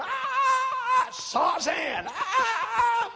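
high shrieking witch laugh through the words; witch cackle. Acted. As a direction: cackle it like a wicked queen. Jezebel mocks the prophet.

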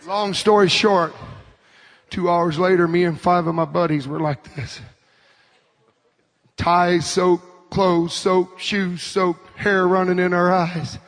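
low, hushed storytelling; preach. Real, not acted. Preacher recalling the drenched boys to the congregation, low storytelling. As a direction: tell it low and spent.

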